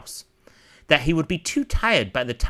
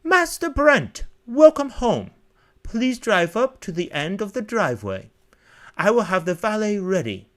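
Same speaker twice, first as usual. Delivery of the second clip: high-pitched, formal butler's welcome; 8 semitones higher, 3 dB louder than usual. Acted. Butler welcoming the young master home, high formal voice. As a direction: announce it grandly in a high butler voice.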